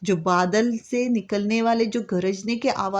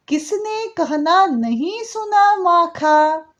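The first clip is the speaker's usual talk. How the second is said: slow verse reading, rising higher and louder at the end; expressive poem recitation; 5 semitones higher, 3 dB louder, slower than usual. Acted. Teacher finishing the poem for the children, slowly and louder. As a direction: build the last lines up with questioning wonder.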